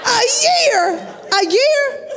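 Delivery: high-pitched incredulous repeat; retold shock. Patient told recovery takes a year, shocked and incredulous. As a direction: burst out in mock outrage at the news.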